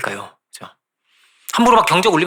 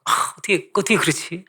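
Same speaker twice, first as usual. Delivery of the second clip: imitated exasperation; 3 semitones higher, 4 dB quieter than usual. Preacher voices listeners hearing the youngest son's story, exasperated disbelief. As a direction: sigh it out like a frustrated listener. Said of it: "막 좀 갑갑하시죠".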